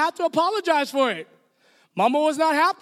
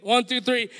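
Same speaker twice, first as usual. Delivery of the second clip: usual speaking voice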